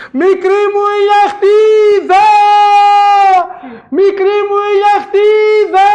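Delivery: long, high, slow shout across the forest; great calling shout. Acted. Husband calling for his lost love, long loud call. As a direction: call her name with all your lungs. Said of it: "έβαλε φωνή μεγάλη".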